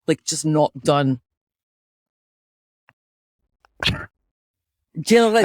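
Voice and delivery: put-on Scottish accent. Host demonstrating the accent to co-host, in a Scottish accent. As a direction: speak with a Scottish lilt. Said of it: "I feel like that was pretty good".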